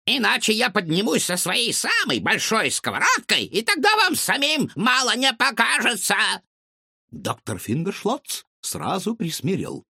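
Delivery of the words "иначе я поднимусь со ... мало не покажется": put-on threatening old woman. A narrator's reading. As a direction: threaten with a frying pan. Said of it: "злобно крикнула соседка".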